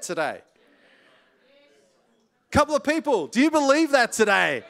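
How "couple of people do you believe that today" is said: higher and louder, calling to the room; rising preacher's challenge; urging call-out. Real, not acted. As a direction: press the crowd for an answer. Preacher urging congregation to answer.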